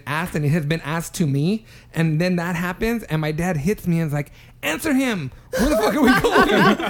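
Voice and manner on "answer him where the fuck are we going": high, louder mock-exasperated outburst; impatient demand. Dad hitting his son to make him answer, exasperated.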